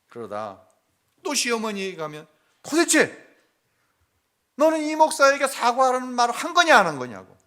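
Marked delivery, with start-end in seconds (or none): 2.6-7.4 much higher and louder, reenacting the scolding; scolding mother-in-law. Mother-in-law scolding the pastor's wife, high and loud. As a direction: hammer the daughter-in-law with the question. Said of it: "또 제 아내를 나무라게 하시는 거예요".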